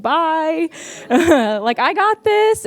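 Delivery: a hint of a breezy little kid brushing off his parents; toddler voice. Son running into school and waving his parents off, lightly voiced high and carefree.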